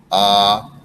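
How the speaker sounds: ah said open and loud; short O as ah; short o sound. Shown as a demonstration. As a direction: open the mouth for the O as ah. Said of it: "Remember, O's ah".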